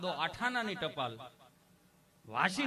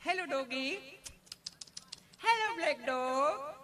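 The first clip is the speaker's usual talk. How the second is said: higher, slow coaxing call to a doggy; mock dog-calling tourist; 7 semitones higher, slower than usual. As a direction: sweet-talk the dog like a clueless city visitor. (કૂતરાને લાડથી બોલાવતો ઊંચો, ધીમો અવાજ)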